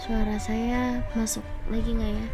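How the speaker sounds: ordinary delivery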